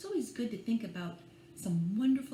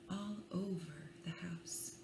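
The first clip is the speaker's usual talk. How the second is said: a hushed whisper at the line end; 9 dB quieter than usual. Acted. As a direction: breathe it out like the dark is falling. Bedtime book, darkness falling.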